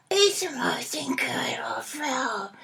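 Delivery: low, slow, whispered trailer voice; film trailer voice. Shown as a demonstration. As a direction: breathe it like a movie trailer. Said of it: "your film voice".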